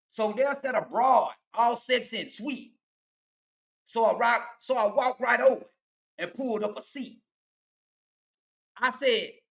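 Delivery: rhythmic street poem delivery; toast recitation. Acted. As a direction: perform it like an old toast.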